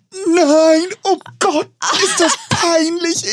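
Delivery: very high, drawn-out cringing wail; mortified reenactment. Retold or imitated. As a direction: squeal in hammed-up secondhand embarrassment. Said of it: "mach das jetzt dann so nach".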